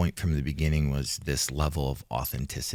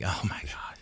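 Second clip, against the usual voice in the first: relieved murmur; 9 dB quieter than usual. Retold or imitated. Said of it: "I'm like".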